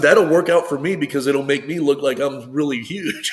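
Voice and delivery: joking delight. Retold or imitated. Athlete jokes about a huge actor playing him, loudly amused. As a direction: crow the joke and let laughter follow.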